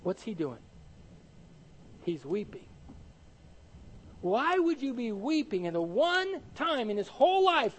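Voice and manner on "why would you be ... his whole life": higher, swooping, emphatic puzzlement; baffled preacher's rhetorical question; incredulous question. Real, not acted. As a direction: ask it as if it makes no sense. Puzzling aloud over the weeping.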